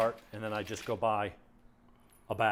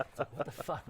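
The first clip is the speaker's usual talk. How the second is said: normal talking voice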